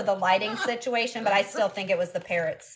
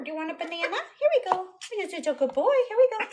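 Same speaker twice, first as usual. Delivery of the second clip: high, sing-song coaxing; 8 semitones higher, faster than usual. Real, not acted. Owner offering her bird a banana, high-pitched cooing. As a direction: coo encouragement at a pet.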